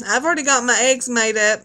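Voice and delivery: ordinary talk, nothing marked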